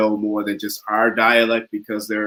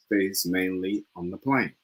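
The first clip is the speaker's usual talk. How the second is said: slow, soft British accent; British accent attempt; 3 semitones lower, 8 dB quieter, slower than usual. Shown as a demonstration. Student practising for the coach, soft British accent. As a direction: finish softly in careful British English. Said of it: "he's a natural at the British accent".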